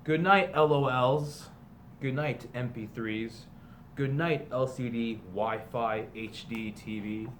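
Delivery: slow, sing-song picture book read-aloud; storybook reading. A narrator's reading. Teacher reading a parody picture book to students, storybook voice. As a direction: read it like a bedtime story to the class.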